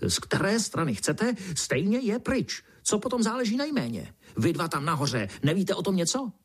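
high-pitched, ranting indignation; worked-up squawky fury; raging beetle. A narrator's reading. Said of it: "černý brouk se vztekal dál".

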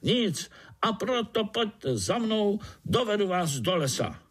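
rally them all in a high voice; excited shouting. A narrator's reading. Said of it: "křičel na celé kolo". (jásavé vysoké volání)

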